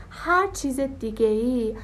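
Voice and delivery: normal talking voice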